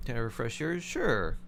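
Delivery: ordinary talk, nothing marked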